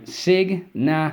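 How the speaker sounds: signature stretched out slowly and pitched high; slow high teacher sounding-out of signature; sounded out slowly. Shown as a demonstration. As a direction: draw out signature syllable by syllable. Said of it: "uh, sorry".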